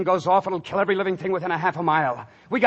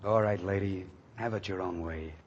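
dejected; 9 semitones lower, 8 dB quieter than usual. Acted. Dejected soldier resigns.

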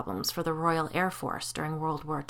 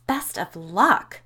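hissed; 8 dB louder, much slower than usual. Acted. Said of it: "Betsy hissed".

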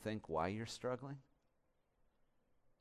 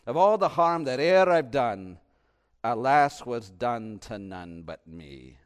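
put-on Scottish accent; 12 dB louder, slower than usual. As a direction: declaim the folk lyric like a Scot. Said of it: "I always say it in a Scottish accent".

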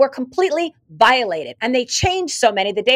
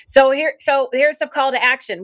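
usual speaking voice

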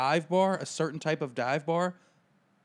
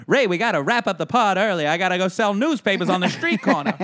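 loud, high, hammed-up 1940s newsreel voice; old-timey newsboy voice; 7 semitones higher, 9 dB louder than usual. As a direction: shout it like a wartime newsboy. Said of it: "Bewitched reference on a podcast".

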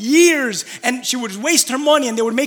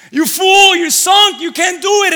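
louder, higher jeering at a sinking man; mocking jeer; 6 semitones higher, 6 dB louder, slower than usual. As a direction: taunt him like a scornful disciple. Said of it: "as they're mocking him".

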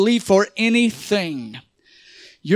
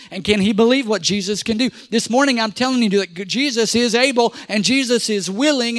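normal talking voice

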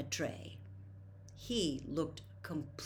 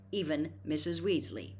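ordinary delivery, nothing marked

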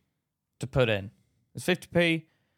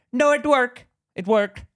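high, loud contradiction; acted dismissive shopkeeper, pitched up; insistent shop lady; 8 semitones higher, 6 dB louder, slower than usual. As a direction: cut the customer off in a higher mock voice. Lady dismissing a customer's complaint.

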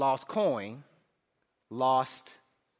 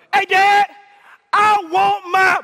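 much higher, louder mock demand; hammed-up shout; 9 semitones higher, 9 dB louder, slower than usual. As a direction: yell the demand to Dad in jest. Son voiced asking his father.

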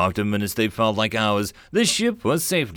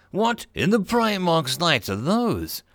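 horrified; 6 semitones higher than usual. A narrator's reading. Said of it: "Reb asked in horror".

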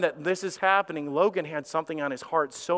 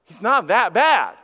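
alarmed protest; 5 semitones higher, 5 dB louder than usual. Retold or imitated. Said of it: "I'm like".

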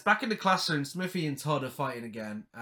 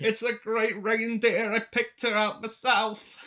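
put-on slow, higher Lancashire girl voice; Lancashire accent; 5 semitones higher, slower than usual. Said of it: "What was with that Lancashire accent?".

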